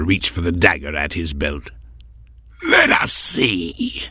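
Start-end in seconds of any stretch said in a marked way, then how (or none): 2.7-4.0 slow, rasping threat; rasped. A narrator's reading. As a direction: drag the words out in a menacing rasp. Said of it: "the cloaked figure rasped".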